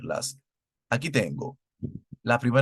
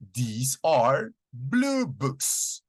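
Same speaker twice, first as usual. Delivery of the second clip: "These are blue books" said slowly, stressing "blue"; slow model answer; 2 semitones higher, much slower than usual. Shown as a demonstration. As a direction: give the answer slowly and clearly. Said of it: "debe ser con these are".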